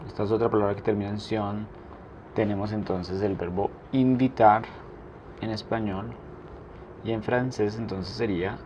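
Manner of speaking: ordinary delivery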